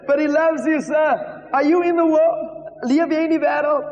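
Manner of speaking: high, emphatic questioning. Retold or imitated. New evangelist challenging a drinker, high and insistent. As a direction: press the point in a high preaching voice. Acting his nervous street evangelism.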